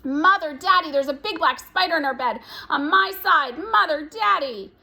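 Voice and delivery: put-on child shrieking about a spider; screaming. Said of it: "went screaming into her mother".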